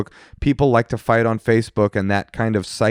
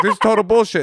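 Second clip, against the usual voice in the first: mock outburst; 9 semitones higher, faster than usual. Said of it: "you storm off screaming".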